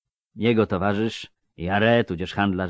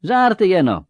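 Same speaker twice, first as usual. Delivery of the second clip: high squeaky squeal; 6 dB louder than usual. Acted. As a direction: piskliwe kwiknięcie. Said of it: "kwiknął Melfi". (kwiknięcie)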